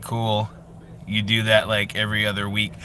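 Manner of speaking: deadpan reply. Acted. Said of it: "the other character's like".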